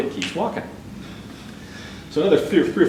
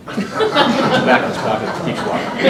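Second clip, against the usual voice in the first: slow, high words broken by real laughter; laughing at his own joke while finishing it; 11 semitones higher, 3 dB louder, much slower than usual. Real, not acted. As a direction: laugh through the punchline.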